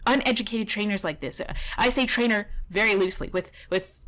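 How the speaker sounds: ordinary delivery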